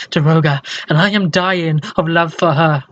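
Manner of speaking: ordinary delivery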